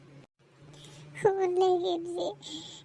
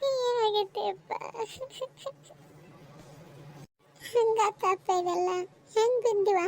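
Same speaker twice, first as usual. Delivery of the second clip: distressed; 4 semitones higher than usual. Acted. Distraught mother questions son's friend.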